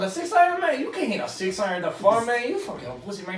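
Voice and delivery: brash Cuban caddy teasing the golfer; Tony Montana Cuban accent. Retold or imitated. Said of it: "like, Tony Montana accent".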